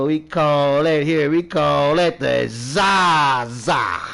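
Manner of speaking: loud, slow, stretched-out hype; loud playful boast. Pretend. Smoker teaching viewers the local slang, loud boast. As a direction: belt out the slang name, dragging it. Hyped naming of his weed.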